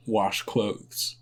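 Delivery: normal talking voice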